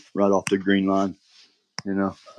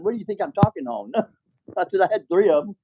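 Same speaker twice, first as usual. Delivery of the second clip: high-pitched incredulous comeback; retelling a comeback to the dispatcher, incredulous and high; exasperated retort; 11 semitones higher, faster than usual. Retold or imitated. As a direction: toss it back at the dispatcher, pitched up.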